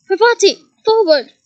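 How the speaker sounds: a brisk teacher's shout; teacher's call. A narrator's reading. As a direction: call her forward with authority. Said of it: "Professor Lupin shouted".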